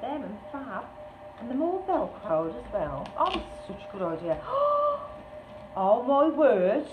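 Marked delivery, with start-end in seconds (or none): 4.4-5.7 high, sharp gasp of delight; amazed gasp. Real, not acted. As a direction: gasp at a wonderful discovery. Gasps at the good idea.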